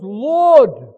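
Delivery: loud, high cry to God; screamed cry. Acted. Stoned man cries out to his Lord, screaming. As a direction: scream it out so everyone hears. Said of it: "He screams it out".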